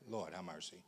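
very low, near-mumbled plea; quiet aside. Real, not acted. Preacher to himself after his point, very quiet aside. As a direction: let it fall away under your breath.

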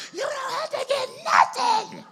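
very high, laughing mock scream; wife screaming up at her husband, mock high shriek; imitated scream. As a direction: shriek it back up the stairs as the fed-up wife. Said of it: "She starts screaming".

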